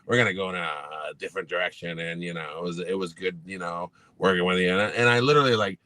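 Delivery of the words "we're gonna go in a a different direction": low, soft, evasive mumble; lightly voiced dismissive coach; imitating Belichick. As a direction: mimic the coach's quiet brush-off. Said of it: "And he's like".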